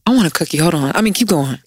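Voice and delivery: ordinary delivery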